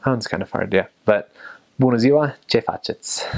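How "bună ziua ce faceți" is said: quicker Romanian accent, dropping away at the end; better Romanian accent. Shown as a demonstration. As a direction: say it with a more native Romanian accent. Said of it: "And then a better one".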